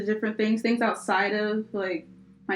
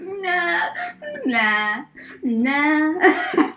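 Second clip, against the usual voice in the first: mock dismissive nah; 7 semitones higher, 4 dB louder, much slower than usual. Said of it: "And I'm over here like".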